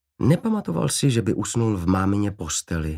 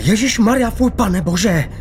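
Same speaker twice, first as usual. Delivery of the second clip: high, loud disgusted shriek; shocked shriek; 10 semitones higher, 6 dB louder than usual. A narrator's reading. Nurse splashed with blood, high disgusted shriek. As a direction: squeal in horror and disgust. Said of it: "vykřikla".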